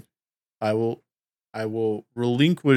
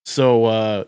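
ordinary delivery

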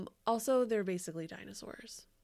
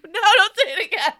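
high, loud laughing protest, half joking; laughing mock panic; 12 semitones higher, 18 dB louder, faster than usual. Friend begs cohost not to summon the ghost, high laughing mock panic. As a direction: yelp it out in laughing mock alarm.

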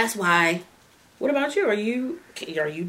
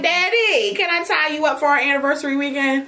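sweet pleading to daddy; 7 semitones higher than usual. Retold or imitated. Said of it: "I'm be like".

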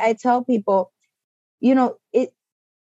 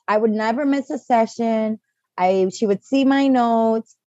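ordinary talk

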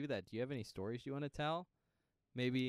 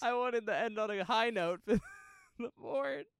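laughing through words; 10 semitones higher, 6 dB louder than usual. Real, not acted. Cohost laughs while explaining pick.

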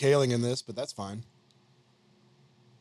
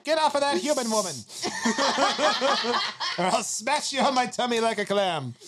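high, fast cartoon otter, laughing; South Park otter; 8 semitones higher than usual. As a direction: shout it like a tiny cartoon critter. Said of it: "it'd just be like South Park".

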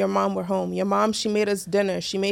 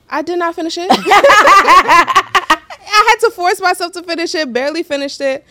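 high and loud, laughing through the words; can barely get it out for laughing; laughing while telling; 7 semitones higher, 11 dB louder than usual. Real, not acted. Confessing to friends about forcing down the beans, laughing. Laughing recalling forced-down meal.

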